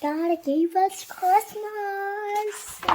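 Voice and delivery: drawn-out repeat. Real, not acted. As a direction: stretch the title out. Child repeats title, drawn out.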